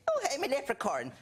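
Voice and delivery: high, hammed-up stage Irish accent; old-fashioned stage Irish. Shown as a demonstration. Comic shows his bad Irish accent to the hosts, hammy stage Irish. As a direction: do a corny leprechaun Irish accent. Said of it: "the really old-fashioned sort of Finny".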